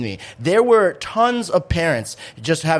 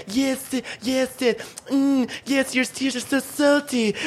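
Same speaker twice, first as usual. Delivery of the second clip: higher, relishing mock kid voice; savoring Cartman imitation; 9 semitones higher than usual. Friend imitating Cartman tasting the tears, mock savoring. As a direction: savor every word like a gloating brat. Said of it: "and he's like".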